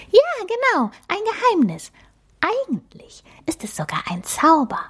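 a cute magic mouse introducing herself; little mouse voice. Acted. The magic mouse introduces herself to Emma, high and squeaky.

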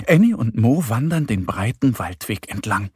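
ordinary delivery

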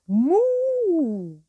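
a high puppet moo; cow moo. Acted. The cow Kurita answering the dog with a moo.

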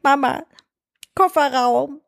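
put-on high little-child voice, separate words; imitating sleepy toddler. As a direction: mimic a toddler's halting announcement. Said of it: "meint dann so zu mir".

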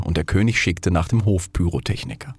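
ordinary delivery, nothing marked

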